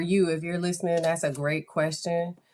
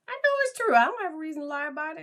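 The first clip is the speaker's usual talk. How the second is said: much higher, fast insistence; emphatic; 12 semitones higher, much faster than usual. Real, not acted. Answering the mother, emphatic, high and fast. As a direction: fire back that it's true, quickly.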